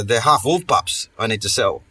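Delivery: ordinary talk, nothing marked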